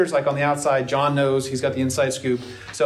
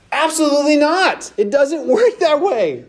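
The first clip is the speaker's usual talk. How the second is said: high, loud emphatic refusal; 11 semitones higher, 3 dB louder than usual. Real, not acted. Preacher flatly refusing the idea, high and loud. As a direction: shut it down hard, laughing through it. Preacher rebukes wishful thinking.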